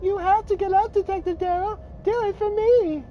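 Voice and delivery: high woman's voice. Acted. Woman voice urges detective up.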